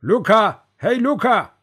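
high, loud hail; calling out. A narrator's reading. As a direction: holler the name to get attention. Calling out a comrade's name.

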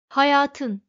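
insistent. A narrator's reading. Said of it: "ısrarla".

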